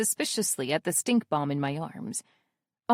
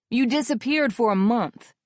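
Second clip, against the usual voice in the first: louder reproach; scolding; 3 semitones higher, 5 dB louder than usual. Acted. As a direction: scold her for vanishing. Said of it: "Corey scolds".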